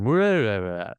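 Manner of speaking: mock babble standing in for a word; garbled gibberish. Recalling what was heard from the woman, garbled gibberish. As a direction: slur it into rolling nonsense. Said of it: "I just heard".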